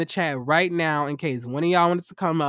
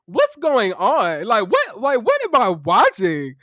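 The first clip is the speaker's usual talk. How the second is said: slow, swooping, high baffled questions; acted disbelief; 4 semitones higher, 4 dB louder, slower than usual. Host replays watching the reunion, loud hammed-up bewilderment. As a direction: stretch the key words in exaggerated confusion. Acts own bewildered reaction to rant.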